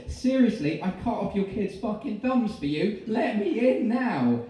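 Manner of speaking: loud, high furious demand; angry shouting. Acted. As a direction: demand entry through the door. Lionel angry at locked door.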